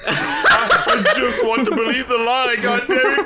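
high, drawn-out wail of mock outrage; mock anguished protest. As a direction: plead in hammed-up despair at being robbed of a cherished lie. Comic protest at a debunked clip.

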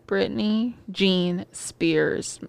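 slow, deliberate name. Real, not acted. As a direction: lingers over each name. Spells out the full name.

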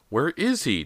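high, urgent question; crying out. A narrator's reading. Boy searching for his puppy, crying out. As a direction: cry it out, pitched high. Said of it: "James cried".

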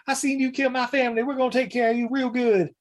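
high mock backwoods kid; hammed-up menace from a kid; vengeful kid. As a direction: threaten him in a high, put-on voice. Retold kid threatens captain.

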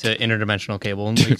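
usual speaking voice

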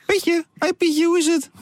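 very high playful greeting; squeaky mock voice. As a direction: greet the caller in a piping falsetto. Greeting squeaky caller in same voice. (hoog piepstemmetje)

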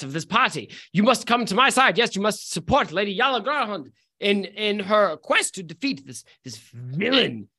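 Julie Andrews. Acted. Lady urging a party guest to join her side, Julie Andrews style. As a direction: play an imperious lady rallying her guest. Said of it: "Julie Andrews are you trying to mimic".

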